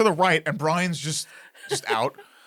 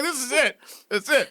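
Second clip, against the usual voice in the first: laughing despair; 7 semitones higher than usual. Real, not acted. Mock despair.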